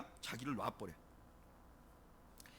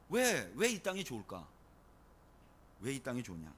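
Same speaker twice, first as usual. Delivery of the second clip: ordinary delivery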